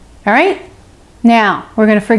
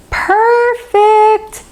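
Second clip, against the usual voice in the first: Perfect pitched way up with glee; delighted high praise; 11 semitones higher than usual. Shown as a demonstration. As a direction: sing out the word Perfect high and bright.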